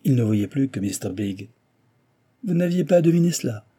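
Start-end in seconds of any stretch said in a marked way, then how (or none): 2.4-3.7 high, loud scornful question; disdainful, raised voice; contemptuous. A narrator's reading. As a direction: throw it at a rival with contempt. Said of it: "reprit l'ingénieur avec mépris".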